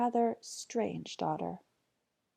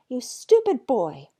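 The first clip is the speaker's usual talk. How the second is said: scolding mother; 4 semitones higher, 10 dB louder than usual. Acted. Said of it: "said the mother".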